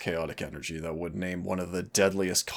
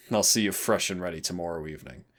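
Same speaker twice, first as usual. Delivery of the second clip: ordinary delivery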